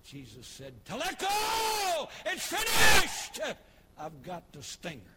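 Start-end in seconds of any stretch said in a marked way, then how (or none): 0.8-3.6 slow, drawn-out shout, much higher and louder; triumphant shout. Acted. Jesus on the cross shouting in triumph, slow and loud. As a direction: cry it out across the church.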